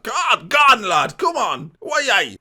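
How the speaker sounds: high, loud Geordie shouts; mock Geordie. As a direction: egg the lad on in exaggerated Geordie. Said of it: "That was more Geordie".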